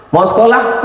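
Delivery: normal talking voice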